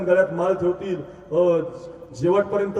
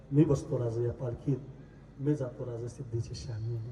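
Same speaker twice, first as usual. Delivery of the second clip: low, sinking, increasingly soft request; lightly acted humble plea, fading lower; subdued plea; 4 semitones lower, 6 dB quieter than usual. Shiva offering himself in the king's place, subdued plea.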